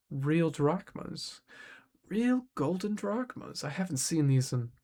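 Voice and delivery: slow, savoring relish; greedy awe. A narrator's reading. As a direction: draw the words out covetously. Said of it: "His fingers hovered greedily over the coins".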